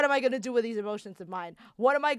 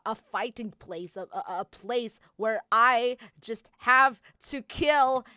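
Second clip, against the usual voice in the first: ordinary talk, nothing marked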